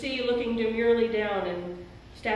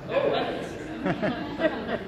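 laughing delight; much slower than usual. Real, not acted. Savoring the word abundance.

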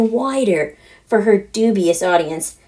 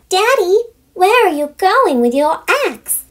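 put-on high, bright little girl calling across the kitchen; 11 semitones higher than usual. Daughter calling after her father leaving the kitchen, high and eager. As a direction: call to Daddy like an eager preteen daughter. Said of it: "she calls out".

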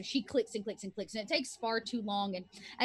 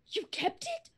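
shocked; slower than usual. Acted. Woman shocked he kept the stick.

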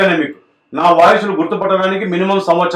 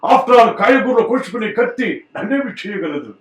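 Senior NTR impression; 4 semitones higher, slower than usual. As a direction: thunder each phrase out like an old film star. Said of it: "senior NTR గారు voice చేయగలరా?".

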